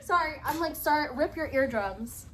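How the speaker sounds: ordinary delivery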